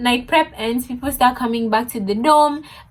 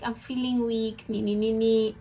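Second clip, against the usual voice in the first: much softer, put-on feeble voice; fake weak whimper; 9 dB quieter than usual. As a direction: play the helpless patient. Faking weakness to boyfriend.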